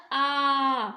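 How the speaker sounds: आ held long and loud, clearly open; clear, strong long vowel. Shown as a demonstration. Teacher shows children how the vowel is pronounced, clear and loud. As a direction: sound out a loud, sustained आ. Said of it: "वो स्पष्ट और ऊंचे स्वर में".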